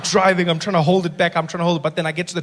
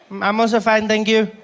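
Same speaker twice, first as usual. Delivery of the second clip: put-on high little boy's voice on the phone; polite child, pitched up; nine-year-old brother; 4 semitones higher than usual. Little brother phones his big brother, high polite child voice. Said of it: "he's too polite".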